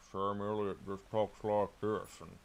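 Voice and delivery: langzaam, lome cowboystem van Sam Elliott. Shown as a demonstration. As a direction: praat traag alsof je snor in de weg zit. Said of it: "sometimes I do Sam Elliott".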